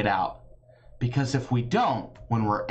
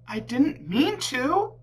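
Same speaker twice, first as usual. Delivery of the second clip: high, pleading, a touch tearful; girl crying; 14 semitones higher than usual. A narrator's reading. Girl crying to her stuffy. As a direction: plead with sorrow. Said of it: "the girl cried".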